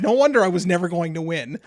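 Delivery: ordinary delivery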